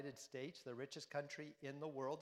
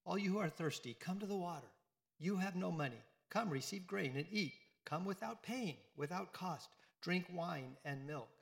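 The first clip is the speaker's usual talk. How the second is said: raised, ringing reading of the passage; scripture reading; 3 semitones higher, 4 dB louder than usual. Acted. Priest reads Isaiah's invitation to the congregation, raised and ringing. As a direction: proclaim the verses to the congregation.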